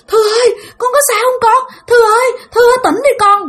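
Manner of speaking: high, pleading mother's cry; frantic calling. Retold or imitated. Mother checking her reviving child, frantic calling. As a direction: imitate a worried mother wailing the name.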